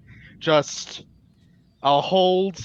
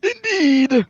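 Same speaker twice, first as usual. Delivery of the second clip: high squeaky voice; 9 dB louder than usual. Acted. High exclamation in character.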